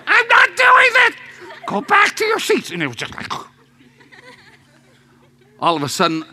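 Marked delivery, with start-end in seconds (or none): 0.1-2.7 high, loud yell of refusal; a high, loud, defiant holler; defiant shout. Retold or imitated. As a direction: shout it back like a stubborn kid. Kids defying at camp.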